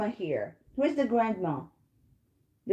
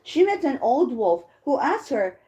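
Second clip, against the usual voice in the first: ordinary delivery, nothing marked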